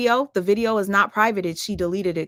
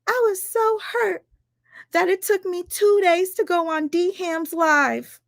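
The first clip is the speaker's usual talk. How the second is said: much higher mock voice, quoting her excuse; mocking Ashley; 9 semitones higher than usual. Mocking Ashley's excuse about D Ham's live, in a high mock voice. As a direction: parody her self-pitying line. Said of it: "Y'all know what she said".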